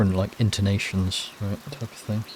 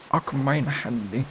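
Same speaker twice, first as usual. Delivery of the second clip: higher, hammed-up German accent; 5 semitones higher, slower than usual. Player testing an accent for a game character, mock German. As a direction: try out a playful German accent. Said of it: "do a German accent".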